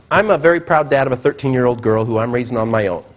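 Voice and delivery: ordinary talk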